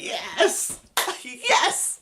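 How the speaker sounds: loud high cheer, climbing to a laughing yell; re-enacted excitement. Fan re-enacting hearing Bus Driver, elated yell. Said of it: "And I was like".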